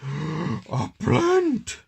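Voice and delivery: gasp, then loud high outburst; horrified yell. A narrator's reading. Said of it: "yelled Mom in horror".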